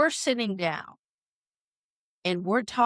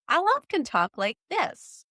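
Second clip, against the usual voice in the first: put-on much higher, sing-song voice for little kids; higher child-directed tone; 8 semitones higher than usual. Shown as a demonstration. Showing how she talks to young children, high and melodic. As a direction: lift the pitch way up as if to a small child. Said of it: "talk with a higher tone".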